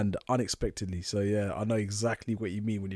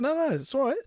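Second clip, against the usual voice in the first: cocky tipsy insist. Retold or imitated. His tipsy self insisting on more.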